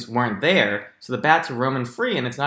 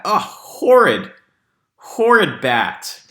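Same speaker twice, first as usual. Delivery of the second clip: slow, louder, higher disgusted groan on Oh, horrid; 5 semitones higher, 6 dB louder, much slower than usual. Shown as a demonstration. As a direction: drag out the disgust at the bat. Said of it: "Look at the bat".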